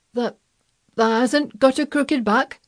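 put-on faltering, hesitant old man's question; hoarse. A narrator's reading. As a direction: stammer it hoarsely in Yorkshire dialect. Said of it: "he said hoarsely".

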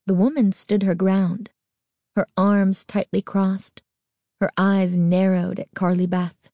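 usual speaking voice

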